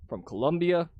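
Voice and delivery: usual speaking voice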